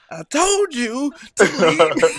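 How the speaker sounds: loud mock kid shout; angry little boy. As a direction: imitate a fed-up boy yelling at his sister. Son standing over his sister.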